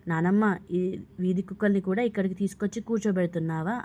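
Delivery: ordinary delivery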